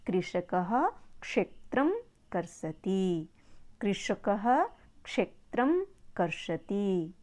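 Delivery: slow, syllable-by-syllable Sanskrit recitation; teacher's careful, spaced-out dictation; slow model pronunciation. Shown as a demonstration. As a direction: draw out each word for learners to copy. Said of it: "उच्चारण सीखना बहुत ज़रूरी है".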